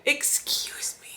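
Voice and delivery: slow, whispered excuse me in disbelief; shocked whisper. Real, not acted. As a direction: breathe it out, scandalized. Shocked aside after quote.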